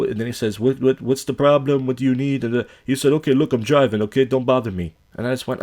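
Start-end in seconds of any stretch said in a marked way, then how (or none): 0.6-2.4 higher-pitched mock Russian accent; imitated Russian accent. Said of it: "Sounds like a Russian guy". 3.1-4.9 mock brush-off in a Russian accent; imitated Russian accent. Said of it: "Sounds like a Russian guy".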